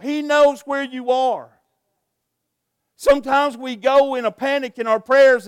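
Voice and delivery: loud, high, urgent preaching; preaching at full force. Acted. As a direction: drive the congregation with rising fervour. Preacher exhorts the congregation.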